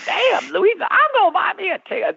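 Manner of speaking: very high, hammed-up astonishment; amazed guards. Guards hearing Luis won the lottery, mock-amazed exclaiming. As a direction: imitate someone floored by a friend's luck. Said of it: "they were just couldn't get over that".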